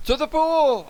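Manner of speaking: very high, thinner cry; boy's yell. A narrator's reading. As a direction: echo the call in a high-pitched boy's yell. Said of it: "I yelled".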